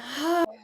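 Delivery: loud sigh on the out-breath; demonstrated sigh; audible sigh. Shown as a demonstration. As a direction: release it with a sigh. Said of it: "you're gonna audibly sigh".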